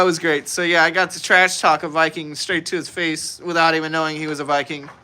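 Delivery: normal talking voice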